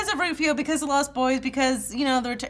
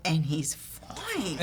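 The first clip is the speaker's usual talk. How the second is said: sultry; 8 semitones lower, 12 dB quieter, much slower than usual. Retold or imitated. Acted swooning quote.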